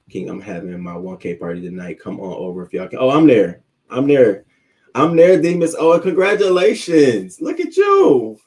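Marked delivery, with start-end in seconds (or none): 3.0-5.8 loud, high, eager acceptance; hyped yes to a party; excited. Real, not acted. Excited reply to 1K party invite. 5.8-8.4 thrilled shout for a friend's milestone; excited congratulations. Real, not acted.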